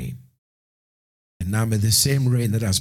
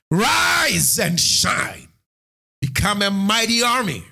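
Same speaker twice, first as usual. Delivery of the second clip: high, shouted proclamation; fervent preaching; 8 semitones higher than usual. Acted. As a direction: call them up with fire. Preaching at full force.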